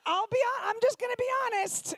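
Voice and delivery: teasing rant at crowd; exasperated. Real, not acted. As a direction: blurt out the honesty. Scolding the audience.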